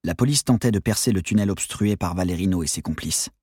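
usual speaking voice

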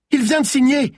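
very high, loud yell; urgent yell. A narrator's reading. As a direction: yell it out in alarm. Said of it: "hurla Malines".

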